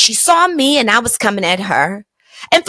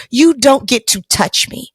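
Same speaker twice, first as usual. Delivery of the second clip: normal talking voice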